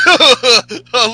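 loud, high mock jock laugh; Ryback. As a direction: laugh like a cocky meathead. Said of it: "he has this fucking jock laugh".

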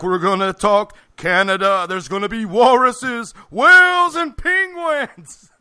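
slow, booming announcer, rising high; hammed-up TV narrator; Saturday Night Live announcer voice. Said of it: "like, the voice of the guy from Saturday Night Live".

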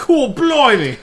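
loud, high, slow mock Cockney; hammed-up British exclamation; mock British accent. Host joins the accent joke, loud mock British.

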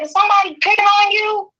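high-pitched, protective urgency; acted-out anxious demand; alarmed mother. Mother asking crying daughter who is bothering her, alarmed.

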